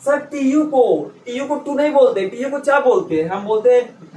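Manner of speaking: ordinary talk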